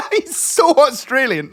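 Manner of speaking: high, loud and slow, cracking up; laughing disbelief. Real, not acted. Telling friend about the host, loud laughing disbelief. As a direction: draw it out loudly through laughter.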